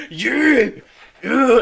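loud, high heaving yell; effort grunt. Acted. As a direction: grunt as if hoisting a heavy body. Acts out hoisting the troll up.

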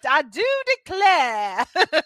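hammed-up cartoon Southern belle, stretched slow; Southern drawl. Recalling the cartoon character, a slow, mocking Southern drawl. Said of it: "with that southern drawl".

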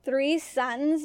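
ordinary delivery

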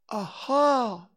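high, drawn-out exclamation; experimental, stretched-out rehearsal; practicing. A narrator's reading. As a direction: try the word out for size.

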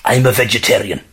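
ordinary delivery, nothing marked

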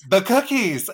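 high, loud cookie cry; excited. Real, not acted. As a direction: gush with delight. Remembering favorite cookies.

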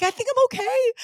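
very high-pitched, unsure-sounding reply; squeaky mock reassurance. Answering her worried husband about the closet, high mock reassurance. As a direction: squeak it out high, imitating herself.

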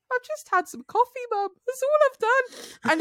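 high, slow innocent voice; innocent protest. Retold or imitated. Daughter telling her mum it was only coffee, slow high innocent voice. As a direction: plead innocence slowly. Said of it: "I said".

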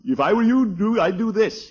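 high, pompous mimicry of Bronco; mocking Bronco. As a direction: ape the bossy nephew's advice. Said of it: "he tells me".

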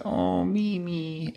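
high, slow, soft coo; tender cooing. Retold or imitated. Boyfriend retelling how he greeted her return, tender coo. Retold soothing of upset girlfriend.

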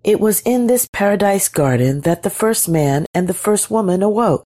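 ordinary delivery

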